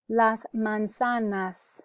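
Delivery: "Las manzanas" drawn out for repeating; slow, deliberate "Las manzanas"; careful pronunciation model. Shown as a demonstration. Teacher gives the word for apples, slow careful model. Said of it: "You can repeat for pronunciation".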